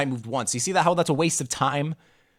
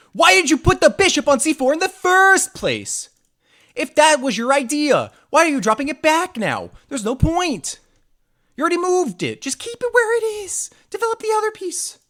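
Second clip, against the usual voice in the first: exasperated high voice; 13 semitones higher, 4 dB louder than usual. Pretend. Berating the opponent's bishop move.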